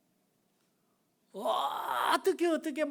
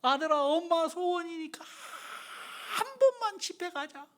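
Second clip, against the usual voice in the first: slow, higher tearful plea; mother pleading tearfully; 6 semitones higher, slower than usual. Retold or imitated. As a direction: beg like a crying mother. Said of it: "막 울면서". (울며 애원하는 어머니 흉내)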